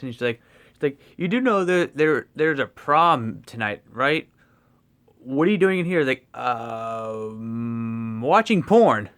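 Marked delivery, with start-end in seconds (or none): 1.2-6.0 prying teacher. Retold or imitated. Teacher questioning Ned in lab. 6.4-9.1 a hesitant um, then a sudden loud blurt of the answer. Retold or imitated. Ned's first quoted answer.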